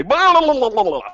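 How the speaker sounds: high, loud blathering gibberish; mock-idiot babble, starting loud and high; Michael Bay impression. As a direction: blurt it out as high-pitched dopey babble. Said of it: "my impression of Michael Bay as".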